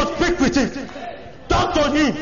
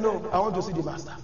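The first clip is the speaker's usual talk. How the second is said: low, soft, fast insistence; mother's plea; 8 semitones lower, 9 dB quieter, faster than usual. Retold or imitated. Preacher voicing the mother to Elisha's servant, low quick plea. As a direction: press it quietly, she won't be moved.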